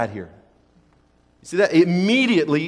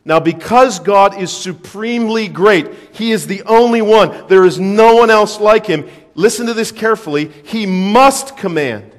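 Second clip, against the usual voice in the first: forceful preaching; 4 semitones higher, 7 dB louder than usual. Acted.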